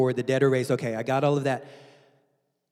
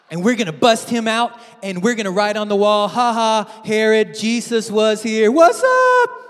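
higher, louder, ending in a shout; gleeful prison-break boast; 6 semitones higher, 11 dB louder than usual. Acted. Preacher mocking a rebel Jesus, loud gleeful shout. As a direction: hype it up to a yelled finish. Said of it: "Nor does he do this".

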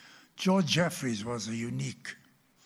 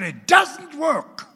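put-on high, loud, slow childlike insistence; boy insisting; 15 dB louder, much slower than usual. Little boy telling his dad the Bible is broken. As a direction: plead it like a frustrated small boy.

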